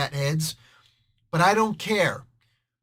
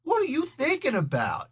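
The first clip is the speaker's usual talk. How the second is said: put-on higher, tough New York Italian accent; a streetwise New York Italian accent, not clowning around; 8 semitones higher than usual. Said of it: "the New York Italian accent".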